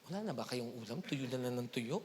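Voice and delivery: lightly voiced gossiping neighbour; mock neighbour. Voicing a complaining neighbour.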